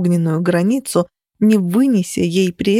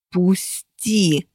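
hissed. A narrator's reading. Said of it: "прошипела я".

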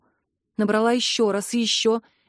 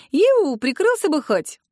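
брезгливо; 6 semitones higher, slower than usual. A narrator's reading. Брезгливо одёргивает брата.

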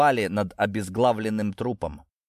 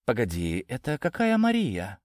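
puzzled question rising in pitch; taken aback; 3 semitones higher than usual. A narrator's reading. Natasha hearing of her real mother, taken aback. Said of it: "опешила Наташа".

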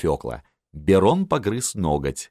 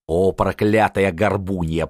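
ordinary delivery, nothing marked

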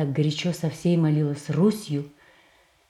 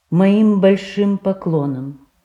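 entreaty; 4 semitones higher, 6 dB louder, slower than usual. Acted. Irina bows low to the prince.

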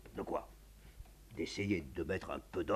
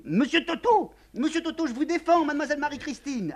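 much higher maid crying out; shrill cries; 11 dB louder than usual. Acted. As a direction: scold the fighting children at the top of her voice. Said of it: "on entend Ada crier".

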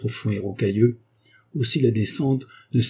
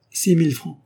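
higher, louder offer; 8 semitones higher, 4 dB louder than usual. A narrator's reading. The doctor raises his offer aloud to the wood merchant, higher and louder. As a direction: announce the sum out loud. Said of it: "Et il dit tout haut".

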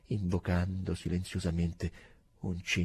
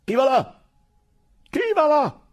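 loud, much higher challenge call; a sentinel calling out at dusk; sentry's shout; 11 dB louder than usual. Acted. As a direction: bark the sentry challenge into the dark. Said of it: "poi gridò".

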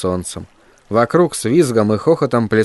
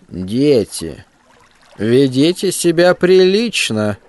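nasty creaky schoolteacher voice; 3 semitones higher, slower than usual. Acted. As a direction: creak it out slowly like a mean old teacher. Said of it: "противно скрипучим голосом".

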